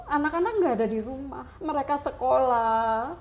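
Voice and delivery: ordinary delivery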